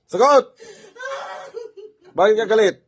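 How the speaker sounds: high, loud demand for an answer; shouted interrogation. Real, not acted. Healer demanding why the spirit is angry, shouted interrogation. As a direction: fire the question out at high pitch.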